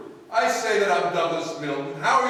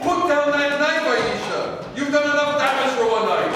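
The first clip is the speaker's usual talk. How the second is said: loud, high, forceful order; urgent command; 9 semitones higher, 6 dB louder than usual. Acted. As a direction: shout her down while wrestling the knife. Struggling to disarm Aisha.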